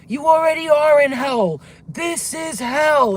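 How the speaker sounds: loud, high, drawn-out scream; demonic shouting. Retold or imitated. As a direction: imitate a scary giant yelling in argument. Retold screaming argument with demon.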